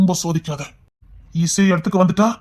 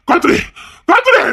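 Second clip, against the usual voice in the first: furious yell rising. Acted. An enraged man shouting at her to take off the dress. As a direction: scream the order twice, harder the second time. Angry man repeats order.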